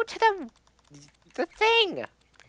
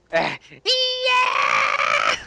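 dramatic cry; 3 semitones lower, 6 dB louder than usual. Acted. Mock dying cry in game.

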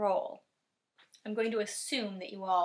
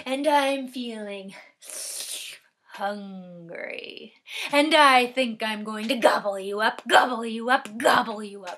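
menacing troll voice; 7 dB louder than usual. Acted. Troll threatening to eat the little goat, loud and menacing. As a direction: threaten loudly as a greedy troll. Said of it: "said in his best troll voice".

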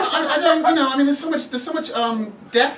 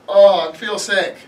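usual speaking voice

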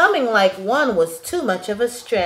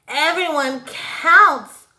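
higher, louder, slow chant; cheering shout; 6 semitones higher, 5 dB louder, slower than usual. A narrator's reading. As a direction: call it out together. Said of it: "the numbers shouted".

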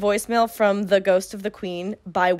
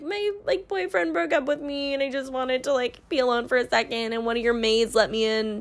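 fake tearful whine. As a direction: imitate a girl turning on the waterworks. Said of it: "she turns on the waterworks and she's like".

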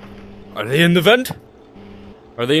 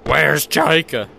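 ordinary delivery

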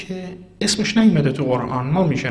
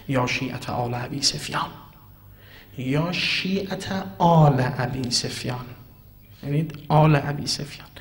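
normal talking voice

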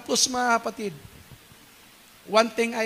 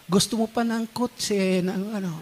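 ordinary talk, nothing marked

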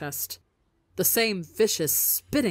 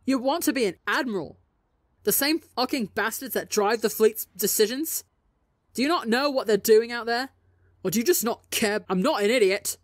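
raised, furious questioning; angry argument; 5 semitones higher, 4 dB louder than usual. Acted. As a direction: hurl the questions at him. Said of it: "matching him pace for pace in volume and dangerous emotions".

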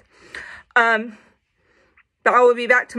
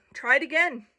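plain reading voice

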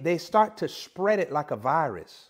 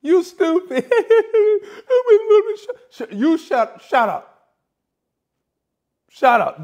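higher, louder laughing retort; playful mock "you stupid", higher and louder; casual woman's reaction; 10 semitones higher, 8 dB louder than usual. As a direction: toss it off like a woman laughing at a joke. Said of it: "you might say it casually".

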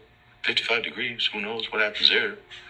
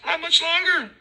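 very high squeaky cop voice; mocking officer. Mocking Officer Yi answering him, very high squeak. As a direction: squeak it out dismissively.

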